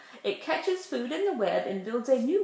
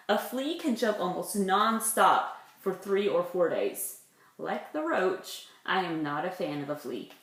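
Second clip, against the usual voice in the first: ordinary delivery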